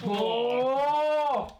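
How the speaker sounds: loud, high disgusted oh; disgusted shout. Real, not acted. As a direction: cry out at the awful taste. Reacting to disgusting taste.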